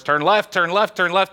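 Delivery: half-yelled command to a horse; lightly acted shouting at a horse; acted yelling. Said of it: "he's yelling at the horse".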